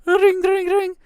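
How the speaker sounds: high sung ring ring of a phone; playful imitation of a ringing phone.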